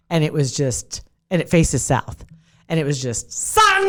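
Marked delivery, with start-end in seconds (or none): 3.3-3.9 "sun" drawn out long; a long, held "sun" to show relentless heat; emphatic SUN. Retold or imitated. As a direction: stretch "sun" out for dramatic emphasis. Dramatizes blazing sun on patio.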